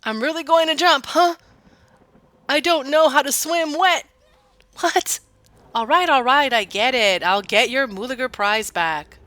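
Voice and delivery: loud, high hammed-up protest; dramatic yelling. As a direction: shout it like a melodramatic old farmer. Joe threatens to jump, shouted.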